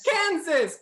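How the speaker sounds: high, loud eager exclamation; excited shout. Acted. Dialect coach seizing host's last prompt, excited shout. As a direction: pounce on the word loudly.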